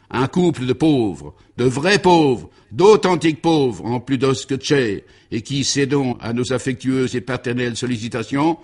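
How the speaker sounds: orate to the whole ballroom; pompous speech. Acted. Baron's speech continues.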